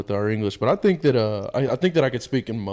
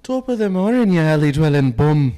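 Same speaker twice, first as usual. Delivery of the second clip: put-on Irish accent; 3 semitones higher, 7 dB louder than usual. Friend performs the requested Irish line, loud and hammed-up.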